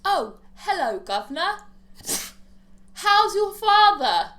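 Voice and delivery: a loud cartoon Cockney as an American would do it; bad American English accent. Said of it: "American doing an English accent".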